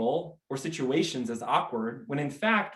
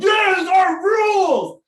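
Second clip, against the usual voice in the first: huge drawn-out yell, much higher and louder; rowdy shout; 11 dB louder, much slower than usual. Retold or imitated. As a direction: bellow it like a drunk fan at the wrong venue. Beer drinker shouting at gallery.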